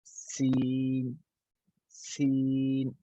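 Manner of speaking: सीन with a long, soft whistling s; drawn-out hissing s. Shown as a demonstration. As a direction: stretch the s of सीन into a long quiet hiss. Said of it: "दूसरा हर्फ क्या है?". (सीन की सीटी वाली लंबी आवाज़)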